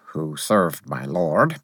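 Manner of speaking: ordinary delivery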